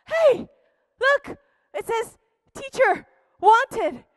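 high, loud "hey" then a bright read; excited discovery. Acted. John spotting the teacher-wanted sign, thrilled. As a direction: catch sight of hope.

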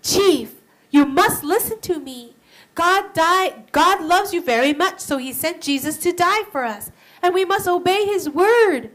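pleading earnestly. Acted. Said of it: "she would tell the chief".

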